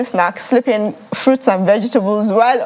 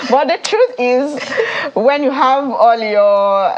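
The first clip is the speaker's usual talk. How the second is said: usual speaking voice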